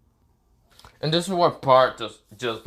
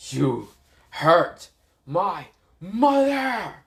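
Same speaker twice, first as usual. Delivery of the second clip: higher, word-by-word angry cry; Boruto's slow fury; 5 semitones higher, much slower than usual. Acted. Boruto accusing his mother's attacker, slow angry cry. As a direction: spread the words out, building to a long final cry. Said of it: "He's like".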